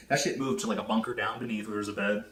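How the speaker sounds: usual speaking voice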